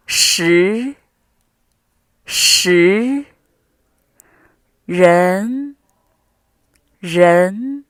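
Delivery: 您, 零, 十, 人 each drawn out with an upward glide; rising tone. Shown as a demonstration. Teacher leading word drill in second tone, each syllable rising. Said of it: "The second one is a rising tone".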